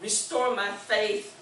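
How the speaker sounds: slow, pleading cry to the Lord; pleading prayer. Acted. Pastor modelling a cry to God, slow plea. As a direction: beg God gently for rest. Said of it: "Me need to cry and say".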